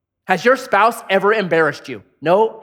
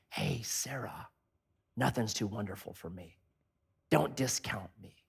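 gentle whisper; 6 semitones lower, 16 dB quieter than usual. Retold or imitated. God whispering to Sarah not to discount him. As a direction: whisper it tenderly to her.

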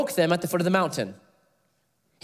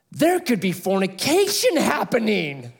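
wide-eyed mock alarm, higher, through a laugh; shocked; 6 semitones higher than usual. Billy gasping at the co-ed dorm, mock shock. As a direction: play the shocked foreign guest, laughing. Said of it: "his eyes got just giant".

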